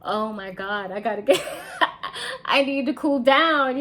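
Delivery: a hammed-up panicky guy cooling off; flustered Jerry. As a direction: play Jerry, flustered and overheated, laughing it off. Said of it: "Jerry is like".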